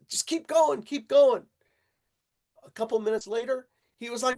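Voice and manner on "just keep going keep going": higher and louder, pushing him on; urging. Retold or imitated. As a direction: keep him going, firm and loud. Urging panicking friend to continue.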